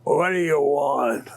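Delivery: slow, wheezing ancient dog; wheezing old dog. Acted. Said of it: "and he wheezed".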